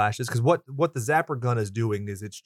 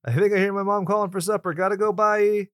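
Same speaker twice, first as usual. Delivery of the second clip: mock kid excuse; 8 semitones higher, 3 dB louder than usual.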